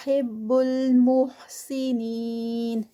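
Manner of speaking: slow, drawn-out tajweed chant; slow melodic Quranic recitation. Shown as a demonstration. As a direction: recite it with careful elongation. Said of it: "نین پر four counts".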